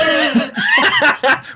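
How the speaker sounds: sudden high-pitched laugh; high burst of laughter. Real, not acted. Laughing at accent idea.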